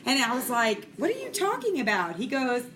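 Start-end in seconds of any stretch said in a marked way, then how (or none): 1.0-2.2 much higher, incredulous question; retold bafflement. Mom questioning her son's odd word, high baffled retelling. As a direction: replay a baffled mom, pitch jumping up.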